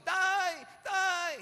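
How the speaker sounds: very high, loud drawn-out shriek; acted piercing kid's cry for help; child screaming for dad. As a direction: yell out for your father at full pitch.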